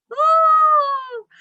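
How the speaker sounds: high joyful whoop; happy cheer. Acted. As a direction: cheer loudly with delight. Letter found, cheering.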